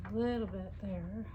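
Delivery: tight, held-breath murmur; holding breath. Real, not acted. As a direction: talk while holding your breath. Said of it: "And I'm holding my breath".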